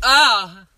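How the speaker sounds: loud, high "ah" of pain; yelp from a slap. Real, not acted. Slapped by the co-host, loud yelp.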